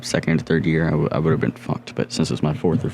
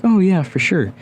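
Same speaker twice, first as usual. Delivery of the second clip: eager agreement; 13 semitones higher, 4 dB louder than usual. Retold or imitated. Guys agree to help beginner.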